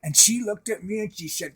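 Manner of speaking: normal talking voice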